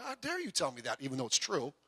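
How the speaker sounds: indignant under breath. Retold or imitated. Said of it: "I'm like".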